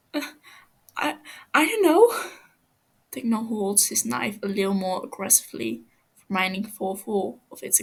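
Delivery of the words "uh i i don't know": higher, stammering and pleading; frightened prisoner begging, voice climbing; scared captive pleading. Acted. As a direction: stumble over the words, terrified of him. Captive answers in fear.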